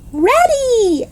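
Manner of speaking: very high yelled ready; eager yell. Acted. Girl answers her mother she's ready, high eager yell. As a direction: shout it out, bursting. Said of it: "I yelled".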